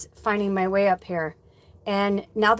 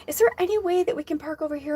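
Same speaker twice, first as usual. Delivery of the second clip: higher, fast sugary request; super kind; 7 semitones higher, faster than usual. Retold or imitated. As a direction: politely plead with the attendant. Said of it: "was super kind".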